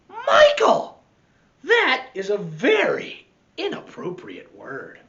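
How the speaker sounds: high, loud cry of the sister's scolding; scolding big sister. Acted. Sister scolds Michael on the bus, high and loud. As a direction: sister shrieks at Michael to stop him. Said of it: "Michael's sister said".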